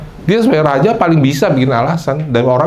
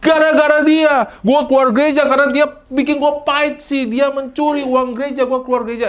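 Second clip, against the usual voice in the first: bitter church-leaver whine; 13 semitones higher than usual. Retold or imitated. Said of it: "Saudara nggak bisa bilang".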